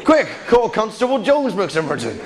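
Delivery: hammed-up West Country villager; broad mock West Country, pitch swooping; bad West Country accent. Villagers raising the alarm over car theft, mock West Country accent. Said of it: "shit attempt at the accent".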